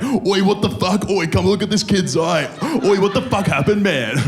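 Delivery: high, rough mock-lad yell; popular kid shouting. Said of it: "he'd be like".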